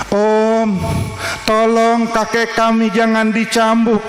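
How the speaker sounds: a hint of small boys pleading; lightly voiced grandsons begging the man; pleading children.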